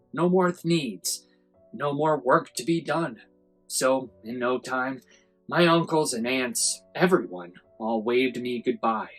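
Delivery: ordinary delivery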